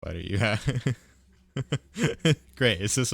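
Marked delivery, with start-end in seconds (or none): none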